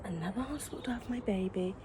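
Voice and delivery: breathy whisper with no voice; whispered aside. Real, not acted. Telling viewers about the transfer, in a hushed whisper. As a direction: whisper it like a secret.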